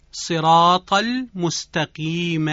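صراط المستقیم drawn out slowly, final میم closed without vowel; pausal recitation. Shown as a demonstration. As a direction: stretch صراط المستقیم and stop on a silent میم. (وقف بر میم ساکن در صراط المستقیم)

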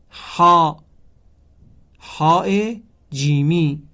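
each Arabic letter said loud and apart so students can repeat it; recite along. Shown as a demonstration. Teacher repeats the letters for students to say along, loud on each letter. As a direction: lead the class through the letters, leaving room to echo. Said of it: "شما هم با من همراهی کنید".

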